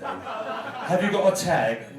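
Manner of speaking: slow, pointed officious question; a hint of a stern gatekeeper; officious steward. Door steward stops them at Pride.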